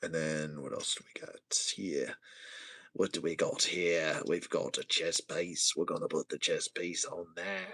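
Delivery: low, soft character voice; low put-on voice. Host showing the chest piece, low hushed voice. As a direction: drop low and keep it quiet.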